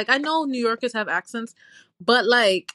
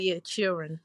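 put-on softer, lower Southern-accent echo; copied Southern accent; 4 semitones lower, 7 dB quieter than usual. Echoing grandma's word with delight, copied Southern accent. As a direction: copy a Southern accent back. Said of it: "I gotta copy it".

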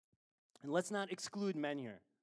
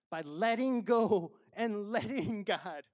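slow emphatic slogan; 8 semitones higher, 12 dB louder, slower than usual. Real, not acted. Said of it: "I kinda crack up at that".

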